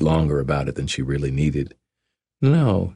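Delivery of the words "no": put-on higher, playful young woman. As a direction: tease him like a Southern belle.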